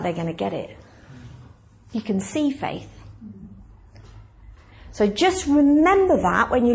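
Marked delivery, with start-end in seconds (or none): 0.0-0.8 quiet assurance. Retold or imitated. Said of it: "And he's like".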